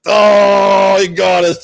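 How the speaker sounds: loud, high "oh, he got us"; caught-out shout. Retold or imitated. Disciples caught arguing by Jesus, sudden loud shout. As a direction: blurt it out like kids caught.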